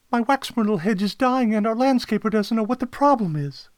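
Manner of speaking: high, loud worried client; distressed caller. Acted. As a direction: lay out the trouble in an urgent high voice. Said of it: "she continued".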